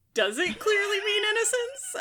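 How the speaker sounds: a high, sceptical echo of his claim; incredulous question. Retold or imitated. Book club friend doubting the narrator, high and incredulous. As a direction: pitch jumps way up in mock disbelief. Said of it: "And I'm like".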